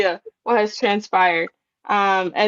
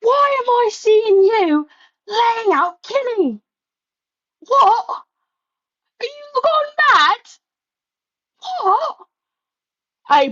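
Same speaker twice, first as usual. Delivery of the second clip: put-on British accent, pleading and rising high in disbelief; cockney Ospreay scolding his friend, then pleading; Ospreay impression; 4 semitones higher than usual. Ospreay confronting Swerve about Kenny, in a British accent. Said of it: "can you do an impersonation of what Ospreay".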